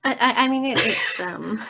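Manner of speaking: flustered false starts with a nervous laugh. Acted. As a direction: stumble over an awkward explanation. Flustered character explaining at awkward dinner.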